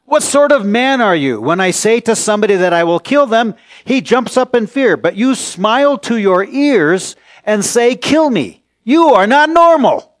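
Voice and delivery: high, loud outraged shouting; furious interrogator. Retold or imitated. Interrogator explodes at the calm prisoner, high furious shout. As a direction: blast him with disbelieving fury. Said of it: "He exploded in fury".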